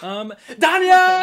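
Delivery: loud, high call across the house; yelling for Danya. Acted. Baroness calling her servant from outside, loud yell. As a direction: shout for the servant outside, slow and drawn out. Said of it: "She yells for Danya".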